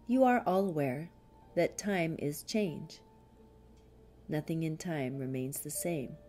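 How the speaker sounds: ordinary talk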